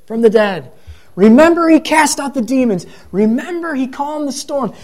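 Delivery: high-pitched, jubilant crowd recalling miracles; crowd's excited praise. Acted. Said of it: "they are saying".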